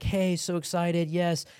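slow, lightly voiced excited wife; mild mock excitement. Wife greets friends surprising her husband, mildly excited. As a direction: imitate a wife's drawn-out, eager welcome.

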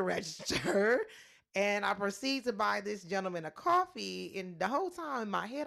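ordinary talk

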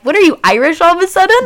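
high, loud, quick mock disbelief; retold teasing question. Co-host imitating Jason's jab, in mock disbelief. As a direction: imitate someone blurting an incredulous question.

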